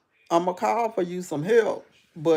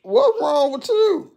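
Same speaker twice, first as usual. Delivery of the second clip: high, loud, slow call to the slumped lady; 5 dB louder, slower than usual. Retold or imitated. Calling to the slumped neighbor, high and loud. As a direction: call out to wake her, laughing. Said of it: "And I kept saying".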